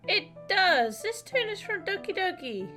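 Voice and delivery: loud, high-pitched burst of excitement; excited recognition. Real, not acted. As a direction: exclaim it loudly as the tune clicks. Recognizing a familiar game tune.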